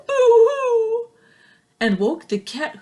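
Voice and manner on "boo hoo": high loud boo-hoo wail; mock crying. Rat woken by the sneeze, loud mock wail. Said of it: "who cried".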